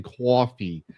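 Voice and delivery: Coffee said as "kwoffee"; mispronounced coffee. Retold or imitated. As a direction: add a w after the k in Coffee. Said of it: "mispronouncing coffee as coffee".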